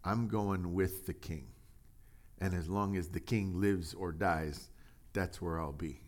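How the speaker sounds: steady and quiet; firm refusal. Retold or imitated. Preacher voicing Ittai refusing to leave, softly firm. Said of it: "He says".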